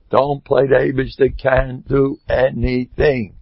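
normal talking voice

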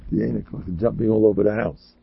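normal talking voice

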